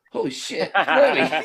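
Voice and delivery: high, loud starstruck gasp of a name. Retold or imitated. Seeing [name] right beside him, blurting starstruck. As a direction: blurt it out amazed.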